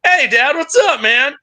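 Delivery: high, bright greeting to dad; mock cheerful greeting. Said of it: "I'm like".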